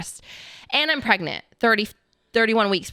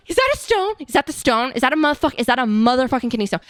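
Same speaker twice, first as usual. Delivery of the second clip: high, loud, escalating questions; frantic disbelief; 4 semitones higher, 4 dB louder, faster than usual. Retold or imitated. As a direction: act out frantic excitement, pressing for an answer. Acting out frantic question to partner.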